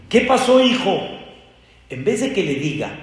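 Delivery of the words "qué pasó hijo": put-on much higher, louder kindly old prophet; a tender elder calling to the boy; prophet asking. Elijah asks the weeping young man, kindly and much higher. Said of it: "le dijo Eliyahu Hanabí".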